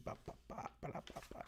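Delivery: voiceless, very soft "ba ba ba"; idle whispered syllables while searching; barely audible filler noises. Real, not acted. Filling time while looking through notes.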